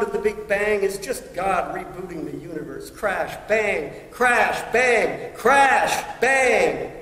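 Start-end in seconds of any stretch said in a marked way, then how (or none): 2.9-6.9 booming crash sounds. Acted. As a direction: punch out each blast, growing to a shout. Acts out the Big Bang.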